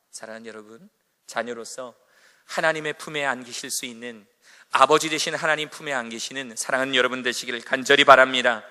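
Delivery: loud, earnest closing blessing; preaching benediction. Acted. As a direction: build to a fervent blessing.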